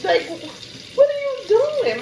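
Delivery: high, loud disbelieving cry; stunned outcry at mom; shocked. Retold or imitated. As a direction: recoil in disbelief as she packs your clothes. Shocked reaction to mother packing.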